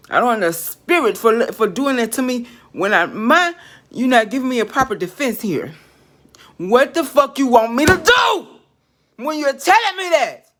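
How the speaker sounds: real anger building from loud to a high yell; furious shout. Real, not acted. Ranting at an unseen spirit, real furious shout. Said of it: "I'm getting very angry".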